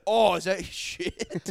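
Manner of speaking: mock dumb question. Friend mocking how a sick man would react, slow high mock voice. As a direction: stretch it out like a hammed-up idiot. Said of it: "You wouldn't have said".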